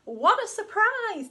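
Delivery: slow loud pleased gasp; delighted surprise. Acted. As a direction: savour the discovery. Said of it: "Digger said with a smile".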